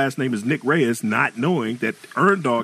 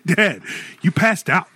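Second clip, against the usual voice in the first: teasing son's voice; 8 semitones higher, 3 dB louder, slower than usual. Retold or imitated. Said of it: "he said".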